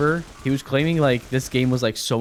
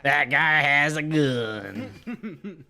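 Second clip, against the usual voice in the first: mock game NPC; much slower than usual. Echoing the game line, laughing.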